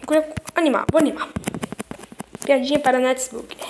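ordinary delivery

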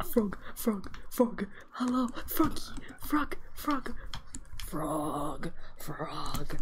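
thrilled hushed whisper; excited whisper. Real, not acted. Player whispering excitedly at a frog so it won't hop away.

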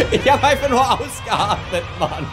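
laughing. Real, not acted. Laughing at his own fail.

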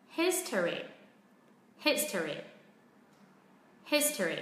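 "History" as HIS-ter-e, falling after HIS; weight on HIS, drop the rest of "History"; stress on first syllable. Shown as a demonstration. Teacher repeats for learners, first-syllable stress on "History". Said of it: "vẫn rơi vào âm tiết thứ nhất".

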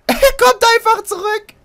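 very high, loud squeal of hilarity; shrieking laughter. Real, not acted. Player sees the monster simply come back, shrieking with laughter. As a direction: squeal it out, cracking up.

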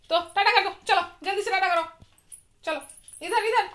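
loud, high coaxing. Real, not acted. Urging toddler to wave bye. (बच्ची को ऊँची, ज़ोरदार आवाज़ में मनाते हुए)

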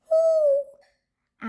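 high, loud owl call; owl hoot. A narrator's reading. Owl calls into the dark night, high hoot. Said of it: "Owl called".

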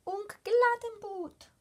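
high, loud, slow bug cry; excited bug cry. Acted. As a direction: cry out in wonder like a tiny bug. Bugs cheering flower.

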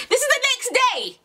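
very high-pitched disbelief; incredulous. Real, not acted. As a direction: squeal it in amazed protest. Incredulous at his quick message.

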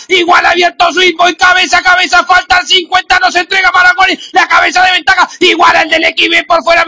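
shouting finish call; horse race commentator. Real, not acted. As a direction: yell the photo finish. Horse race call final stretch. (narrador hípico gritando el final)

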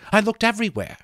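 Molly nervously excusing herself; nervous, stumbling. A narrator's reading. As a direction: stumble over the words, nervous. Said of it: "she said nervously".

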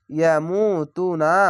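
ইয়ামুতুনা slowly, long "moo" and long "too"; one-alif elongation. Shown as a demonstration. Teacher modelling a Quran word, slow ইয়ামুতুনা with long "oo" vowels. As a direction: draw out both "oo" vowels in ইয়ামুতুনা. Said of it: "এক আলিফ টেনে পড়বেন".

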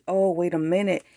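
usual speaking voice

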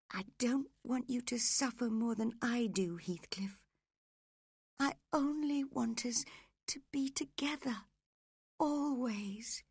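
high, tender pleading; high-pitched longing woman; dying Catherine. Acted.

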